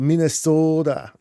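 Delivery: drawn-out, lightly put-on Minnesota accent on "Minnesota". Streamer jokingly picks the Minnesota accent over Cali girl, lightly put-on Minnesota accent. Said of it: "your Minnesota accent".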